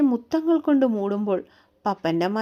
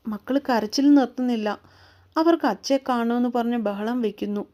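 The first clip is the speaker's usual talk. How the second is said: usual speaking voice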